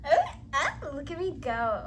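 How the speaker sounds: high, loud proud exclaim; excited. Real, not acted. Cheering herself on while building the shelf, loud and excited. Proud of her own progress.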